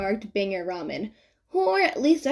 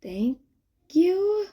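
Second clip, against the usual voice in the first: confused mutter; 9 semitones higher than usual. A narrator's reading. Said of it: "he mutters, confused".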